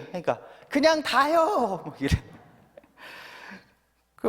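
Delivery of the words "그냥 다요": put-on child's sulky answer.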